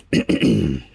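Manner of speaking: hoarse, voice going after clearing throat; scratchy voice, losing it. Real, not acted. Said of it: "like I'm losing my voice here".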